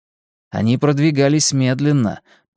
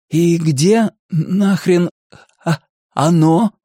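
slow, raised voice with halting breaks; stuttering demand; 6 semitones higher, 3 dB louder, slower than usual. A narrator's reading. Stuttering boy asks the whole group where it is, halting. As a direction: force the question out through a stammer. Bill stutters, asking all of them.

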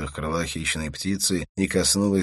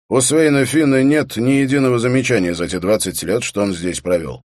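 loud, raised official voice; official prison guard; 7 semitones higher, 9 dB louder than usual. A narrator's reading. Prison guard briefing visiting policemen, loud and official. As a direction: state the rules loudly and higher.

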